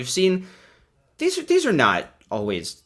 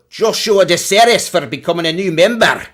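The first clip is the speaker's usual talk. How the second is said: put-on higher, louder go at a Scottish accent; attempted Scottish accent; 7 semitones higher, 8 dB louder than usual. Streamer thanks a new member in a higher, louder Scottish accent. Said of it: "That sounds like it might be Scottish".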